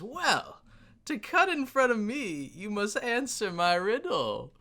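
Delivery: high pompous riddler voice; professor dwarf voice. Acted. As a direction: play a smug professor dwarf. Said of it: "and he says".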